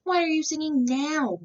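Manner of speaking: high, loud exasperated demand; growled. A narrator's reading. Mayor finds the caged rooster singing again, exasperated loud demand. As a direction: demand it in outrage. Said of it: "growled Don Pepe".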